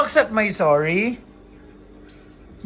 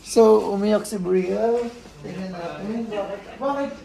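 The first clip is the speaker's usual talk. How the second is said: normal talking voice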